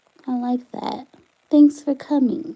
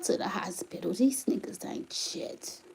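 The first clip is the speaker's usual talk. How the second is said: hushed, breathy aside; emotional whisper; 5 semitones lower, 14 dB quieter than usual. Real, not acted. Mother upset over her partner's hospital trip, whispering. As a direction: whisper it, upset and holding back. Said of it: "really making me emotional right now".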